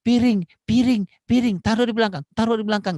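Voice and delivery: put-on high, loud nagging order to a child; bossy parent command. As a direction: bark out the chore like a nagging mum. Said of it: "nggak bisa bilang". (ibu menyuruh anak dengan suara tinggi dan keras)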